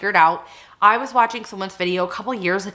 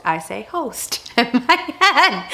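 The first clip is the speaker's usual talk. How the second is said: giggling; 5 semitones higher, slower than usual. Real, not acted. Telling viewers about ghost host, giggling. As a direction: giggle through it.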